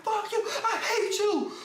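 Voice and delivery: very high, drawn-out retold tantrum; mock screaming. Son held back by mom, mock-screaming at his dad. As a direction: imitate yourself shrieking through angry tears, hammed up. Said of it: "I'm screaming".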